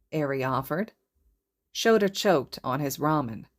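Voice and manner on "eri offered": usual speaking voice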